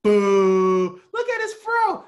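high, far-off jeering crowd voice; mocking crowd heckle. Fans at the show clowning him, high mocking jeer. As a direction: mimic a crowd booing and roasting him. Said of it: "They said".

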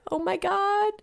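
surprised. Real, not acted. Realizing the year is ending.

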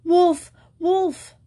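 high, loud cry of wolf; shouting for help. A narrator's reading. Shepherd boy shouting to the villagers, loud and high. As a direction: yell it to the village. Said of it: "shouting at the top of his voice".

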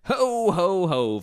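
high, loud mock Santa ho-ho-ho; Santa laugh. Host greets the fellas in holiday ad, Santa laugh. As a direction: belt out a playful Santa greeting.

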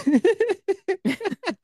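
high burst of laughter. Real, not acted. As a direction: let out a squeaky laugh.

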